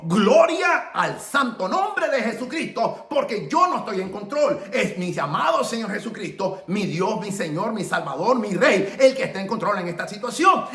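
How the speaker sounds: rolling, insistent declaration of faith; cry of praise. Acted. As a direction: proclaim it to heaven. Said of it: "volamos un grito al cielo y decimos".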